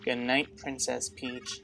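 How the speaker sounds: soft, slow and eerie; hushed unsettling goodnight; creepy goodnight. Acted. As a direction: drag it out quietly like a creepy farewell. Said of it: "That's kinda creepy".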